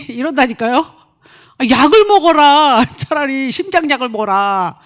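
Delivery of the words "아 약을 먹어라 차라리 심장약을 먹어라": loud, slowed mocking jab; half-laughing, exasperated scolding. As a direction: tease them to take their pills. Mocking a sulky person.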